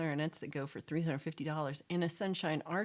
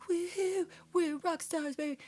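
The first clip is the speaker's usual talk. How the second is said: high, loud whooping hype; mock celebration; 12 semitones higher, 4 dB louder than usual. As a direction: imitate a friend whooping it up. Acting out friend's celebrating text.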